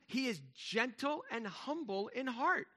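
slow, reverent emphasis; set-apart scripture quote. Real, not acted. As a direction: savor every word of the promise. Quoting the verse, set apart.

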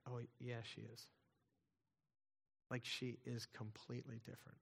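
a quiet awed retold reply, breath taken away; breathless hush. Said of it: "it took almost her breath away".

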